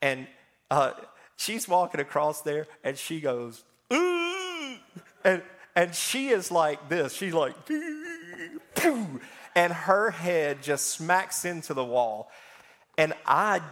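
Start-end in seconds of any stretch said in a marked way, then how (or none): 3.9-4.8 high-pitched mock "ooh"; high tripping squeal. Preacher mimics an old lady tripping, high squeal. 7.7-9.2 high whistly squeal with a whoosh; high flying squeal. Retold or imitated. Preacher mimics an old lady hurtling toward a wall, high squeal.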